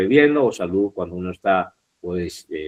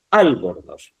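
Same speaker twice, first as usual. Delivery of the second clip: ALBORNOZ stressed hard on AL, falling after; stress on first syllable; 3 semitones lower, slower than usual. Shown as a demonstration. Teacher trying the accent on syllable one, stress on AL in ALBORNOZ. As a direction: hit the AL of ALBORNOZ and drop the rest. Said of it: "si yo le pusiese la tilde en la primera sílaba".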